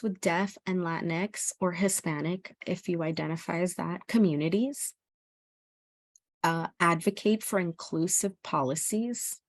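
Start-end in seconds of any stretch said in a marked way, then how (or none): none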